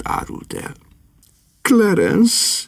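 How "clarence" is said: high rising call of a name. A narrator's reading. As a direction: call out to him in alarm. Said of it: "kiáltotta".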